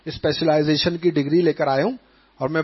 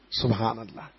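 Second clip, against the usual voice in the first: choked up; 10 semitones lower, 10 dB quieter than usual. Real, not acted. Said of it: "emotional exclamation".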